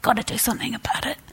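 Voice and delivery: high, hurried, softly fretting; mock a quick, anxious inner voice; anxious urgency. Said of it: "our response then can be anxious".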